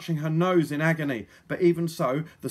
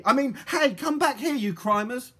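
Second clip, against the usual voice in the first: put-on high, raised girl's protest; calling after robbers; 9 semitones higher, 5 dB louder than usual. A narrator's reading. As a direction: catch yourself and call them back. Polly calls after fleeing robbers.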